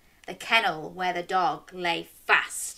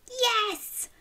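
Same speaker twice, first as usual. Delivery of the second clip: excited yes. Pretend. Answering for the audience.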